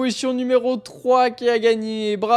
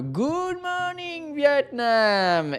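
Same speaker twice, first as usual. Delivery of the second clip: "Good morning" stretched very slowly and high, then "Vietnam" drops back; mock yell; 5 semitones higher, much slower than usual. A narrator's reading. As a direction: belt it out the window like the film's radio call. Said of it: "ouvre la fenêtre et hurle".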